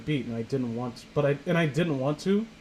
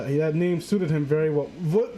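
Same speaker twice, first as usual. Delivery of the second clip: normal talking voice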